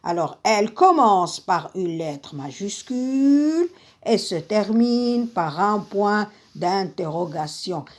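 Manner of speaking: ordinary talk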